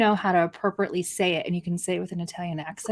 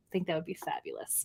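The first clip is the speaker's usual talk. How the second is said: whispered, half to herself; teasing whisper; 3 semitones lower, 13 dB quieter than usual. Acted. A host to viewers, in a sly whisper. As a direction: breathe it out as a sly aside. Asking viewers for accent videos.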